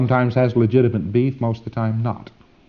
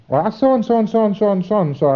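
fast, higher-pitched mock babble; rattled-off excuse; 8 semitones higher, faster than usual. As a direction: imitate a client gabbling on. Acts client rattling off excuses.

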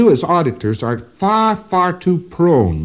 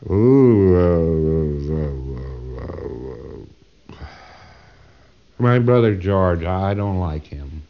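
dredge up the answer, deep and trailing away; acted pondering patient; 9 semitones lower, much slower than usual. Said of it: "And the person thinks for a moment".

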